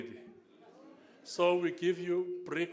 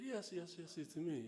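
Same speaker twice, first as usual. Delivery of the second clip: Namibian English; Namibian accent; 3 semitones higher, 8 dB quieter than usual. Retold or imitated. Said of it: "in Namibian English".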